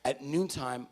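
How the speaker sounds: ordinary talk, nothing marked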